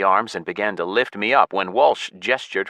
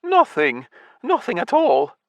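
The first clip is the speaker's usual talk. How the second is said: high, quick flustered denial; embarrassed mutter; 3 dB louder than usual. A narrator's reading. Patrick denying to Walsh, high and flustered. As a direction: brush it off, embarrassed. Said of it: "Patrick blushed and muttered quickly".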